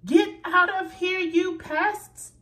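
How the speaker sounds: loud high yell; shouting. A narrator's reading. The gardener shouting the pests out of her garden. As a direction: chase them off with a yell. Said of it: "the gardener shouted".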